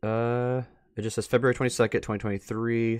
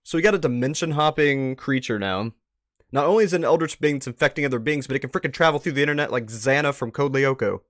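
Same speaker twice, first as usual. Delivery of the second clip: alarmed disbelief; 5 semitones higher, 9 dB louder than usual. Real, not acted. Shocked reaction to the recording.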